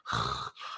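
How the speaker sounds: a soft, voiceless little gasp; imitated baby's struggling breath. Father imitates his newborn daughter's troubled breathing, soft voiceless gasp. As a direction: mimic the newborn's strained breath sound. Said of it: "had this noise like".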